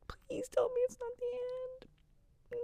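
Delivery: hushed, pleading whisper; anxious fan whispering a plea to her viewers. Real, not acted.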